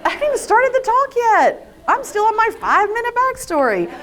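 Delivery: high, exasperated wail at oneself; tearful. Real, not acted. Speaker crying before the talk has begun, tearful. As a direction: complain through tears, voice pitched high. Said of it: "I don't even know why I'm crying".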